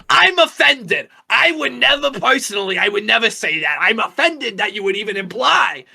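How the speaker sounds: mock outrage, loud and high-pitched; Michael's loud offended protest. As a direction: play up being deeply offended, loudly. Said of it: "And Michael says".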